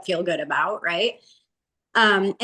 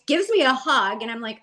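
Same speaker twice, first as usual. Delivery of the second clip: normal talking voice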